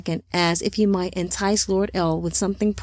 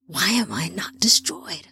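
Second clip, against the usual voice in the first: slow, voiceless whisper; agonised whisper; 2 semitones lower, 3 dB quieter than usual. Acted. Tormented man to himself, slow agonised whisper. Tortured man's agonised thought.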